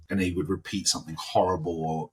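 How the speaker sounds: usual speaking voice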